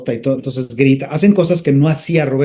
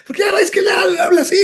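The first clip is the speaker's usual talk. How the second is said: much higher shouted raspy character voice; Rafael Inclán impression; faster than usual. Telling producers Inclán should voice Yondu, raspy shout. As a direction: blast it out like the old comic actor. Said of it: "por qué no le hablan a Rafael Inclán".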